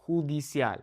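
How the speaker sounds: judicial joined as hoo-dee-SYAHL; Spanish "judicial", j as h. Shown as a demonstration. Teacher joins it up, Spanish judicial.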